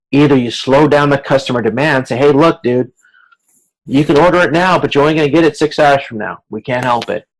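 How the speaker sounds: usual speaking voice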